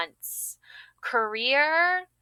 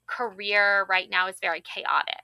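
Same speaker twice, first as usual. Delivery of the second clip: ordinary talk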